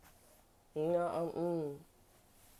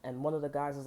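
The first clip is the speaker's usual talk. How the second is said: ordinary delivery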